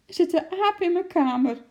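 high, loud frightened wail; panicked wailing. A narrator's reading. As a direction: wail it to your husband in panic. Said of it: "jammerde Mien". (angstig jammerend)

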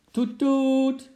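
high, loud horn toots; car horn imitation. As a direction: honk again like the car. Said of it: "klonk het weer".

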